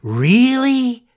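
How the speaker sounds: high, loud joyful Really; joyful cry. Acted. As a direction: burst out with glad surprise. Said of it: "cried Pinocchio joyfully".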